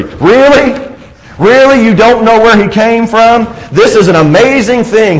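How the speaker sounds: high, disbelieving "Really? Really?"; incredulous. Acted. Healed man mocks the Pharisees' ignorance, incredulous. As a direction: sound amazed at their blindness.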